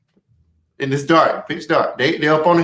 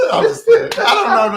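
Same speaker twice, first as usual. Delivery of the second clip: high, giggly backtrack; laughing; 4 dB louder than usual. Real, not acted. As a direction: laugh it off as a joke. Laughs off own joke.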